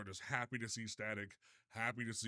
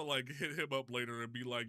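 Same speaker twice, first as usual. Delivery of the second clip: ordinary delivery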